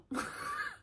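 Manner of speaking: a short laugh, squeaking high. Real, not acted. Laughing at her own delay on the mittens, high squeak.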